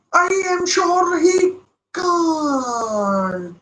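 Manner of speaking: very slow, high, crying lament; groaning fan before the shot; drawn-out wail. Real, not acted. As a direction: stretch each word in despair. Fan doubting the finish.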